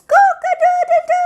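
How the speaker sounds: high, loud crowing rooster; laughing rooster call. Shown as a demonstration. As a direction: crow like a rooster at dawn. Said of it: "to wake everyone up and says".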